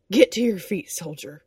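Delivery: barked order, loud then dropping; loud command. Acted. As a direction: bark it like a drill sergeant. Din shoves him awake, barking.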